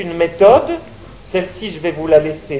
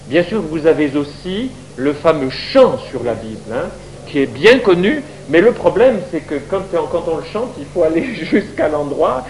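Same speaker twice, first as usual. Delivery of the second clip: usual speaking voice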